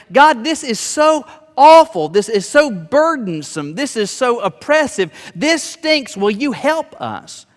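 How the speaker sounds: high, loud, slowed moaning plea; wailing complaint. Acted. The oppressed people crying out to God, high loud wailing.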